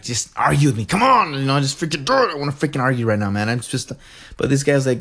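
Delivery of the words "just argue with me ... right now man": lightly hammed-up demand for a fight; playfully worked-up urging; mock goading. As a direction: egg them on in mock frustration. Acts out goading someone to argue.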